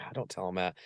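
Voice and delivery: soft, low embarrassed aside. Retold or imitated. Embarrassed at being introduced to kids, a low, hushed protest. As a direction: shrink the voice down, imitating mild embarrassment. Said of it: "I was kinda embarrassed".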